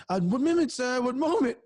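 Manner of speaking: high, polite stalling voice; high timid voice asking for one moment; nervous stalling voice. Retold or imitated. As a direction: play a flustered man behind a door. Buck stalling behind door.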